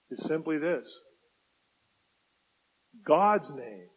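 normal talking voice

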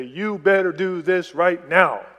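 slow, loud parental warning; mock-stern, emphatic ultimatum; angry parent. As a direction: imitate a parent laying down the law word by word. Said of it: "get angry with you and say".

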